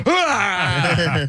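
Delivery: high, imitated yelp of fright; hammed-up startled "ah"; mock startled scream. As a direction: cry out high in pretend shock. Imitates Howie's startled reaction.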